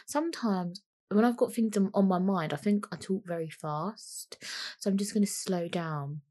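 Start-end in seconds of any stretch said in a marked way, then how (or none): 2.5-6.2 whispered aside, slowing down; whispering. Real, not acted. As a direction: drop to a whisper to calm the pace. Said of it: "am I speaking really fast".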